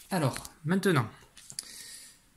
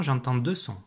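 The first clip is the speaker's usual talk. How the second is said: ordinary delivery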